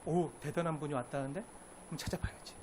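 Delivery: a curious man muttering quietly; hushed inner thought. Acted. Zacchaeus voiced in story.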